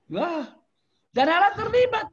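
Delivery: high, loud burst of wonder; excited exclamation. Real, not acted. Preacher marveling to alumni, high excited exclamation. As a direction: exclaim it with joyful amazement.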